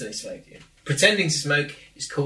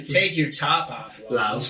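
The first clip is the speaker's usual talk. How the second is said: slow American "Take your top off, love"; American accent; much slower than usual. Shown as a demonstration. Showing Cockney fails in an American accent. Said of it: "things in an American accent".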